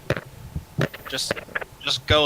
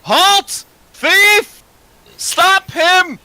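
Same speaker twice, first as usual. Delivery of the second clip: high, full-voiced alarm shout; top-of-the-lungs yell; 8 dB louder, much slower than usual. Sprinting at the lady, yelling to stop a thief. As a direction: raise the alarm at a fleeing thief. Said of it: "yell at the top of my lungs".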